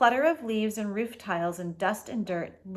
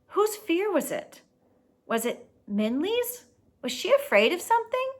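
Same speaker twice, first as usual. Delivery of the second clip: anxious questions; 10 semitones higher, 3 dB louder than usual. Acted. Ba pleading with the fish.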